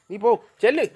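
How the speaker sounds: high, loud command to leave; shooing shout. Real, not acted. Owner chasing a pet away, shooing shout.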